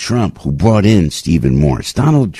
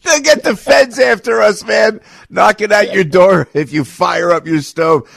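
much higher, shouting through a laugh; excited shout; 12 semitones higher than usual. Real, not acted. Host shouting excitedly about feds knocking at doors. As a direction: fire it out, half laughing.

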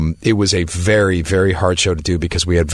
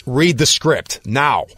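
slow, higher-pitched imitated command; insistent order; 7 semitones higher, slower than usual. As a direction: order it slowly, word by word, as the friend. Friend orders him to read script.

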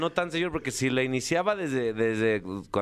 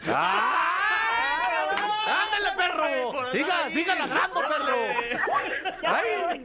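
excited shout; slower than usual. Real, not acted. Cheering a rival on air.

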